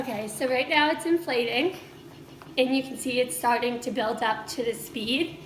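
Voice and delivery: normal talking voice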